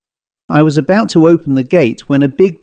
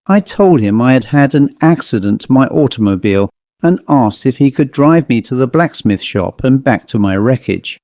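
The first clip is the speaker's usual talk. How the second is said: usual speaking voice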